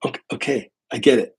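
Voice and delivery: retold irritated concession; a touch of exasperation; mildly pissed off. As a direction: cut off a nitpicker, mildly fed up. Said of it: "And I'm getting pissed".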